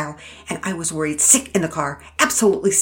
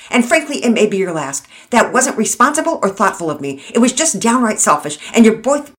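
loud, sputtering scolding; angry dressing down; 5 semitones higher, 8 dB louder than usual. Acted. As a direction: chew them out, furious and flustered. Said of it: "Mama Peters sputtered".

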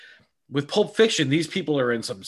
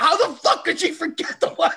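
higher, laughing mock rage; mock fury; 14 semitones higher, 4 dB louder than usual. Man alone in his car furious at his girlfriend, laughing mock rage.

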